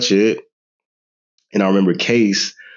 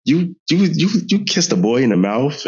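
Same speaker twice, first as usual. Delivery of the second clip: higher, stammering shocked question; shocked mother; 6 semitones higher than usual. Retold or imitated. Mother bursting in on her son's phone call, stammering shock. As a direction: sputter in disbelief. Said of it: "you were like".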